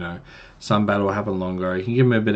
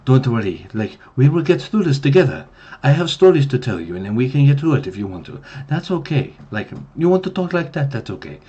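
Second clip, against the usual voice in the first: higher, soft-spoken, vaguely Italian lilt; Lamb's soft Mediterranean voice; 5 semitones higher than usual. Acted. NPC Lamb comforting the players, soft-spoken, vaguely Italian accent. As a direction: gently reassure in a not-quite-Italian accent. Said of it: "voice that sounds really similar to that Mediterranean voice. Like, it's like Italian, but not quite".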